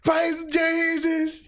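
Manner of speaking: slow, high drawn-out praise; mock a trembling worshipper's cry; high, stretched-out hammed praise; mock preacher. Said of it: "[name] starts shaking".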